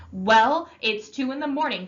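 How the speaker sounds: loud angry scolding. Acted. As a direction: bite out the time like an accusation. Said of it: "He was madder than I'd seen him".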